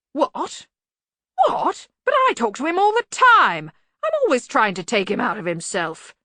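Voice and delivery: high, loud, blustering protest; indignant Arch-Chancellor. Acted. Arch-Chancellor insisting he talks to the bursar, indignant. Ridcully character dialogue.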